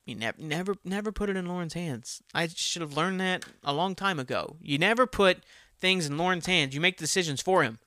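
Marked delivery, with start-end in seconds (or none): none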